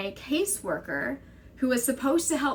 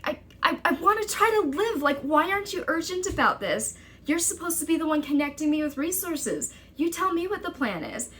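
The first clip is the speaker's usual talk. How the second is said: higher, urgent, near-panicked plea; desperate pleading; 7 semitones higher than usual. Retold or imitated. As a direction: beg her to take it seriously. Pleading argument with caseworker.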